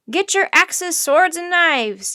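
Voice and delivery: high, loud call to arms; rally the crew with a shout; forceful order, slowing on the list; yelling. A narrator's reading. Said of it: "yelled another".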